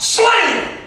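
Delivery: high-pitched, abrupt slam; sudden exclamation. Acted. Preacher acting out the quick stop, exclaiming. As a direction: punch it out like a sudden impact.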